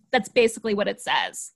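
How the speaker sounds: ordinary talk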